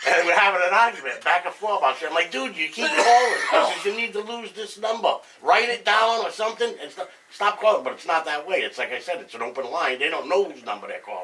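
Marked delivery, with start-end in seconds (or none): 2.3-7.8 higher, louder mock-angry retort, with a laugh; retold shouting match with a pushy caller; heated retort. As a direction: tell the scammer off, half laughing. Argument with scam caller, retold.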